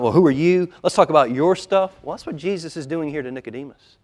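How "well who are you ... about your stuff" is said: louder, frustrated comeback; retold defensive retort, pushed a little louder; mock indignation. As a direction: turn it back on the accuser, imitating irritation. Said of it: "I get angry, get frustrated. I turn it on".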